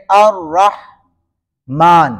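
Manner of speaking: الرحمن with a heavy, full "rra", slowly; الرحمن broken slowly after a heavy "rrah"; thick R. Shown as a demonstration. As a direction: make the doubled R of الرحمن thick and weighty. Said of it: "را جو ہے اسے موٹا کر کے پڑھیں گے".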